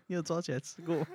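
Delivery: ordinary talk, nothing marked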